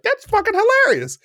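very high, delighted client voice; client cracking up. Retold or imitated. Client laughing at trainer's remark, very high and delighted. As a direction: squeal it out delighted. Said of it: "and he's like".

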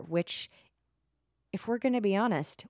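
ordinary talk

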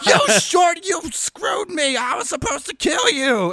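high, slow, indignant cry; outraged shout. Retold or imitated. Zombie Roger cheated of his kill, high outraged shout. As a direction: yell out being cheated.

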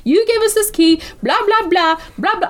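sassy ranting. Retold or imitated. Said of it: "just being like".

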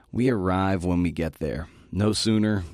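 ordinary talk

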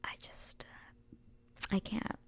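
whispered swooning recap; excited whisper. Real, not acted. Telling viewers about the look, whispered. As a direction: hush it like a secret thrill. Overcome by the scene.

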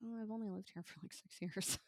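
self-mocking and hushed; mock dismay. Host retelling hearing herself slip into local accent, mock dismay.

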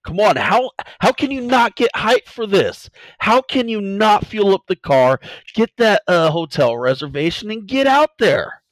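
rising to a full hype shout; wrestling promo. Acted. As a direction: pump up the crowd like a wrestler. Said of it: "This turned into a wrestling promo?".

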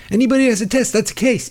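mock Trump. Mimicking Trump on testing.

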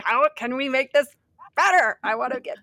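high, loud and eager; eager gym client. Retold or imitated. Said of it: "I'm always like".

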